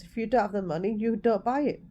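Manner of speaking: normal talking voice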